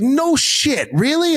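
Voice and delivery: thick Boston disbelief; Boston accent. Retold or imitated. Driver amazed, excited Boston accent. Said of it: "switch accents quick".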